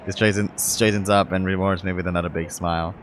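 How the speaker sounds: usual speaking voice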